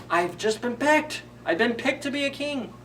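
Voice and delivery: he can't believe his luck; excited. Acted. Little fir tree thrilled at being picked, excited and high. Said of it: "He's like".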